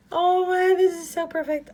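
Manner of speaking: high and loud, thrilled; excited. Real, not acted. Vlogger opening snowman gift from friend, thrilled and loud. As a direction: burst out with real delight.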